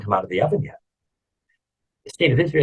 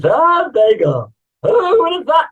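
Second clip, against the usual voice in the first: loud, high-pitched, hammed-up amazement; amazed; 6 dB louder, slower than usual. Onlookers seeing the bagel come out, amazed. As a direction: marvel loudly, in a much higher voice.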